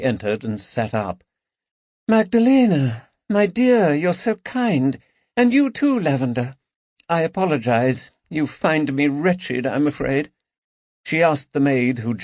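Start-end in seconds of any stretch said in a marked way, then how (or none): 2.1-10.3 high, fragile society lady voice; a wretched aristocrat putting on brave graces; Lady Caroline. Acted. Lady Caroline welcoming her friends, high and fragile.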